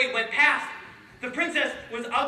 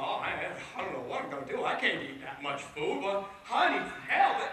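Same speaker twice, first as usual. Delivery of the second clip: lower, softer, fast anxious muttering to himself; worried thinking; 7 semitones lower, 7 dB quieter than usual. Acted. Dumpling panicking at a room full of bread, low and fast. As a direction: fret quickly under your breath. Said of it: "Dumpling thought".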